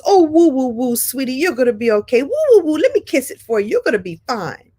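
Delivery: put-on high, cooing comfort for a hurt child; a mother comforting her fallen child, cooing; soothing mom. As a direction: coo it like kissing a scraped knee. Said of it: "the response was".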